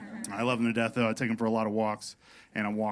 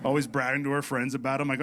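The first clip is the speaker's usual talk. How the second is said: ordinary talk, nothing marked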